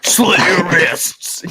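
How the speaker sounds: drawn-out sinister joke voice; joking friend twisting the chant, slow creepy voice; slow creepy taunt. Pretend. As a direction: say it slow like a movie villain. Dark joke voice.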